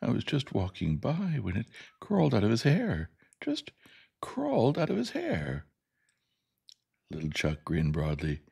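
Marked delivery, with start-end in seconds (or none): 0.0-5.7 high, distressed teacher; desperate, high-pitched account of a horror. A narrator's reading. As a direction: plead your shock, pitch leaping up. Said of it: "Miss Carolyn said desperately".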